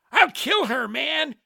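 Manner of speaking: high, loud desperate shout; shouted threat. A narrator's reading. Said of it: "Shotgun shouted".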